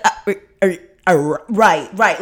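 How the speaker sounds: stuttering er er, then rushing the rest fast; flustered stammer. Retold or imitated. To her dad about the stranger in her car, flustered stammer. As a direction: caught out, fumbling for words.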